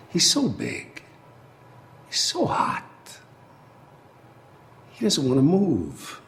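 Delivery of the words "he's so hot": breathy story whisper. Acted. Telling the lion story.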